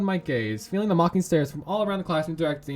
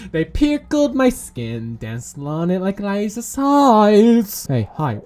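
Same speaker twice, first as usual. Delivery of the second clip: ordinary delivery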